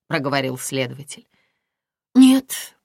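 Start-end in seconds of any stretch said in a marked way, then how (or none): 2.1-2.8 loud, high blurted no; hasty denial. A narrator's reading. Aglaya denies having suspicions to the investigator, hasty. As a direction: cut in hastily, high and loud. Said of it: "поспешно сказала Аглая".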